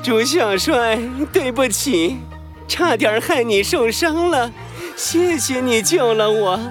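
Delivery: gentle dragon voice. Acted. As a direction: thank him tenderly as a dragon. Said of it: "巨龙先生的声音这么温柔啊".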